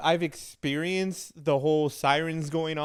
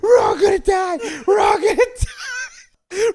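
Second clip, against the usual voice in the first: high, loud wailing shriek, imitating a terrified girl; ballistic panic; 9 dB louder than usual. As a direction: scream it in hammed-up, tearful hysteria. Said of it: "and she goes ballistic".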